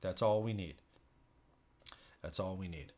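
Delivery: usual speaking voice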